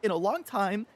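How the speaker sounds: high, fast, incredulous outburst at Xbox; exasperated rant. Retold or imitated. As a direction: rant at them in disbelief, speeding up. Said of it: "and I'm like".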